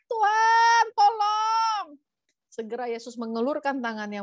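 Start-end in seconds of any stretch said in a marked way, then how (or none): 0.1-2.0 loud, very high cry for help; drawn-out acted panicked shout; drowning scream. A narrator's reading. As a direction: scream out as if going under. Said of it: "mulai tenggelam lalu berteriak".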